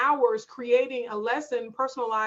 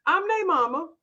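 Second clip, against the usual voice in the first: higher-pitched, self-assured declaration; assertive demo; 5 semitones higher than usual. Shown as a demonstration. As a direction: model a firm answer to doubters. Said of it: "say it in your voice".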